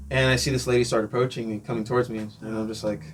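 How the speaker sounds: normal talking voice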